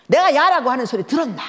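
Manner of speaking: mother questioning daughter, irritated. Retold or imitated. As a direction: challenge her sharply.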